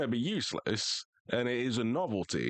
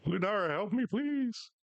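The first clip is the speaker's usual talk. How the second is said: high, pleading call for help; 12 semitones higher than usual. Real, not acted. Gamer begging Ludara to help, high pleading call. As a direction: beg a teammate to save you, voice jumping up. Calling teammate for help in game.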